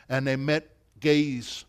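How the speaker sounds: ordinary delivery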